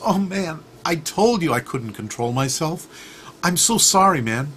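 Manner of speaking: high, distressed mock apology; apologetic scorpion. Scorpion apologizing to the frog he stung, in dismay.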